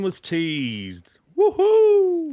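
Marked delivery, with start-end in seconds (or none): 1.4-2.3 loud high whoop; excited whoop. Real, not acted. Cheering news of new Tekken.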